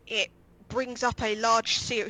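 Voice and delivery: usual speaking voice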